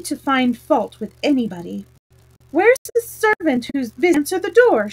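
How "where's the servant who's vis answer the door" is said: sharp, raised demand; Alice snapping at the missing servant, loud and higher; angry. A narrator's reading. Alice demanding at the frog's door, angry and loud. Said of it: "she began angrily".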